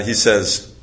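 plain reading voice

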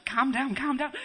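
high, loud urging; acted parent trying to hush a child; flustered parents. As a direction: raise the voice, urgent parental plea. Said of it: "and the parents say".

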